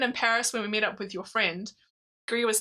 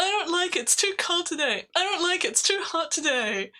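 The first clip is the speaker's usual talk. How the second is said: higher whiny moaning voice; whiny complaining; 7 semitones higher than usual. Retold or imitated. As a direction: moan like a grumpy husband. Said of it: "That impression is excellent".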